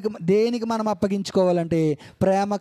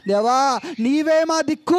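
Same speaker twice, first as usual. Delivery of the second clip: louder, higher cry of "దేవా, నీవే మా దిక్కు"; fervent prayer cry; 4 semitones higher, 3 dB louder than usual. Acted. Preacher voices a believer crying out to God, louder and higher. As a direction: cry out to God with rising fervour.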